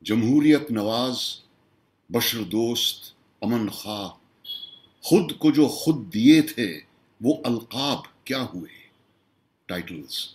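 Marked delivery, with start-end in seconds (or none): 0.0-8.8 measured Urdu nazm recital, pitched above his talk; declaimed mushaira-style poetry; poem recitation. Acted. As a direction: recite it as verse, pausing after each line.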